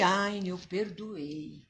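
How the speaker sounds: mock pious brush-off; fake forgiveness. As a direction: shrug it off as a half-hearted lie. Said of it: "Mas eu vou mentir".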